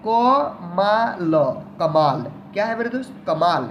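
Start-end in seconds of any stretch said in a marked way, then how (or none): 0.1-1.3 drawn out. Shown as a demonstration. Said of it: "अगला शब्द है".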